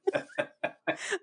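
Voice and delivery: airy, high-pitched burst of laughter; high breathless laugh. Real, not acted. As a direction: let a silent, wheezy laugh escape. Funny microphone costume story.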